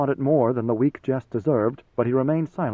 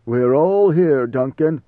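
loud, drawn-out patriarch; grandfather's voice; 6 dB louder, slower than usual. Acted. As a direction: boom it slowly as the grandfather. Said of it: "in the voice of his paternal grandfather".

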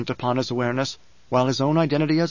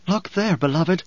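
husky voice; 4 semitones higher than usual. A narrator's reading. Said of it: "In a voice turned suddenly husky".

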